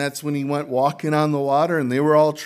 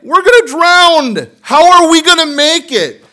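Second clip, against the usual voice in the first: much higher, louder, hammed-up panic; panicked disciples; 17 dB louder than usual. Preacher voicing disciples in the storm, hammed-up panic. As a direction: cry out like men sure the boat is sinking. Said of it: "They're tripping".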